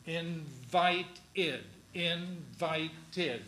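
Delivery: "Invited" with all three syllables sounded, in-vi-ted. Shown as a demonstration. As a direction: say "invited" slowly, every syllable clear. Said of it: "each of these three syllables are pronounced".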